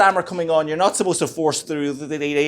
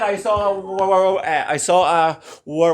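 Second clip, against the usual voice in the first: stammering. Retold or imitated. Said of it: "word that I knew I was going to stammer on".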